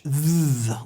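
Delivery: "th" held and buzzed as in this; voiced th. Shown as a demonstration. Teacher isolates the soft D sound, voiced th. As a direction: hold a buzzing "th" alone. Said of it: "will sound like T H".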